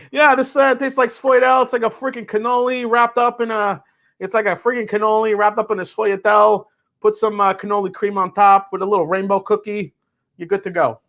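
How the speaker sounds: hammed-up Italian-American food lover; mock Jersey Italian. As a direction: play a Jersey Italian gushing over a cannoli.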